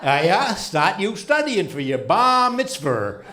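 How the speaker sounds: put-on high, loud old Maine grandfather's accent. Teasing his grandson Bobby about synagogue, loud high Maine twang. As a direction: belt it out like grandpa down east.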